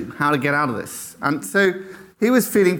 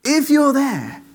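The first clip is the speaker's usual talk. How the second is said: high, strained plea called out to heaven; acted desperate call, pitched way up; top-of-voice cry; 10 semitones higher, much slower than usual. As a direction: cry out to God, stretching the words. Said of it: "at the very top of his voice".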